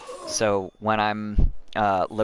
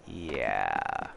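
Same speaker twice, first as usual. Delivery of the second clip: much lower, softer, falling; deflated low yeah; 13 semitones lower, 6 dB quieter than usual. Real, not acted. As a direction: drop it low and flat, deflated.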